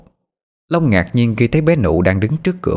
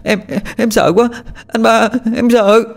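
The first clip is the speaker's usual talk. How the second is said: put-on high, frightened little-girl wail; sobbing child; 14 semitones higher than usual. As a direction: wail in fear to big brother. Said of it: "Nó òa lên khóc nức nở".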